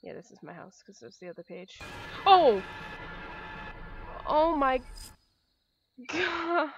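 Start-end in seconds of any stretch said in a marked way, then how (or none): 2.2-6.7 loud high startled cry; startled shriek. Real, not acted. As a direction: jump out of your skin. Jump scare, shrieks.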